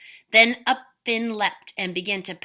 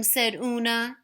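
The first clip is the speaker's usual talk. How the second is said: ordinary delivery, nothing marked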